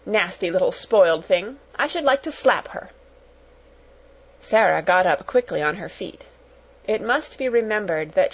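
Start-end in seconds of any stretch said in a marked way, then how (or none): none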